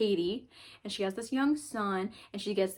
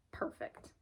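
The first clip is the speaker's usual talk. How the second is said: breathy whispered aside, almost soundless; whispered reaction; 13 dB quieter than usual. Retold or imitated. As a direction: whisper it like a private delight. Reaction to finding the book.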